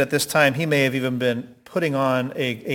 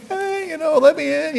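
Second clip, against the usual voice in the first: much higher, urgent pleading at the door; desperate high Peter. Retold or imitated. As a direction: beg to be let in, voice pitched way up. Peter voiced at the window.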